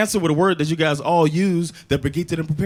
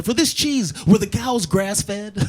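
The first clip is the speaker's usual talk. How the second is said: high-pitched customer question, drawn out at the end; imitated precious shopper, pitched up; hipster customer; 6 semitones higher, much slower than usual. Hipster customer quizzing the boutique clerk, mock precious questioning. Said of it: "Asking me questions I can't answer".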